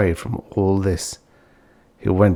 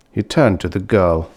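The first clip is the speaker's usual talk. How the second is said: ordinary delivery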